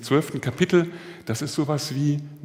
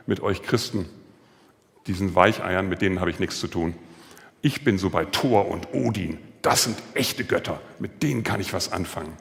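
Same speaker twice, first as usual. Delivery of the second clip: scornful soldier; 6 semitones lower than usual. Retold or imitated. Soldier's scornful words quoted.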